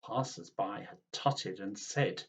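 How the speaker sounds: usual speaking voice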